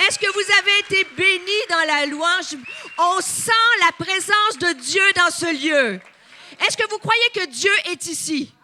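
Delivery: loud, high, rousing call to the church; preaching at full force. Acted. Preacher rousing the congregation after worship, full force. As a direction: fire up the congregation with every question.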